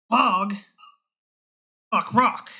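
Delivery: loud, dim orc growl. Acted. Orc to old man, loud and dim. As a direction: bark it like a dumb orc.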